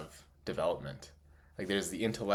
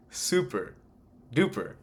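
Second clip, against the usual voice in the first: excited emphasis; 3 semitones higher than usual. Real, not acted. Stressing how excited they are about body work, excited. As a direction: punch super, then duper, each on its own. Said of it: "excited about body work".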